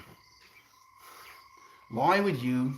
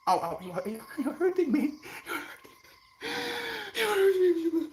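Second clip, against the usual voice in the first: much higher, giggly playful whine; mock-hurt pet voice; faster than usual. Puppy voice complaining to the streamer, high playful mock whine.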